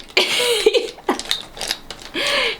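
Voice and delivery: long high-pitched cackle; a long giggle, high up; high laugh. Real, not acted. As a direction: break into a squealing laugh. Laughing at his anger.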